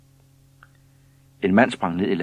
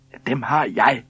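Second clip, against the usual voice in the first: raised, loud woman's retort; first complaining lady; 6 semitones higher, 6 dB louder than usual. A narrator's reading. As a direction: answer up loudly over the squabble. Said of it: "sagde den dame, der var kommet først".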